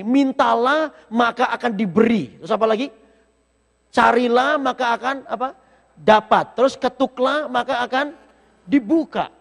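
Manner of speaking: loud, rising preacher's call; preaching at full force. Acted. Preacher quotes the verse to the congregation, loud and rising. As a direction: proclaim the verse to the whole church.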